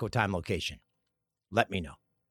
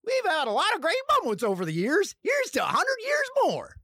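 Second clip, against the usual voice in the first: high, hammed-up Southern announcer; mock voiceover in a high Southern accent. Voiceover guy toasts a hundred years, hammed-up Southern accent. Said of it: "he doesn't say it in a Southern accent".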